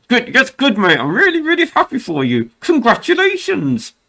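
high, over-sweet fake delight; mock congratulations. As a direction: gush falsely in a high voice. Mock congratulating lucky friend.

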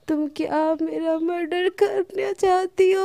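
high, drawn-out mock wail; hammed-up plaintive protest; tearful complaint. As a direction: whine accusingly through onion tears. Onion tears, mock murder accusation.

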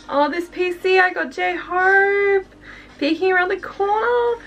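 very high, giddy squeal; overjoyed, voice shooting way up; real fangirl squealing. Real, not acted. Thrilled by friend's photo card surprise.

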